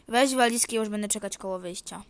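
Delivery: usual speaking voice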